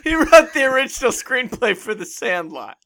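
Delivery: laughing while speaking. Real, not acted. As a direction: talk through laughter. Laughing through the joke.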